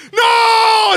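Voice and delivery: high, loud, drawn-out no; hammed-up wail of refusal; horrified scream. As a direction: scream no in comic horror. Acted horror at mother's plan.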